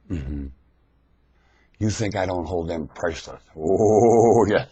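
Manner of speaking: ordinary talk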